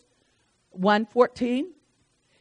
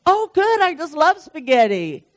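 high, louder, over-polite enthusiasm; feigned delight; 6 semitones higher, 3 dB louder than usual. Pretend. Dinner guest reacting to spaghetti, feigning delight. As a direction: cover disappointment with put-on cheer.

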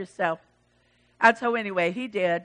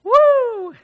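loud "woo" sliding down at the end; high loud whoop; 12 semitones higher, 10 dB louder than usual. Acted. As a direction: let out a high, excited whoop. Preacher whoops mid-sermon.

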